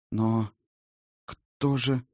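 halting, with pauses between words; reluctant, broken-up delivery; forced-out question. A narrator's reading. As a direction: squeeze the question out hesitantly. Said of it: "выдавила она наконец".

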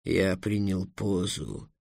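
low, soft, self-pitying dragon; hushed, deep, weary complaint; sulking dragon. A narrator's reading. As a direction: lament it quietly to yourself. Dragon sulks, trying to calm itself.

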